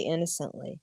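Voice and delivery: usual speaking voice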